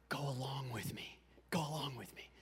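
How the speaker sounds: soft, secretive whisper. Retold or imitated. Son letting mom in on a prank, conspiratorial whisper. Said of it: "I whispered to her".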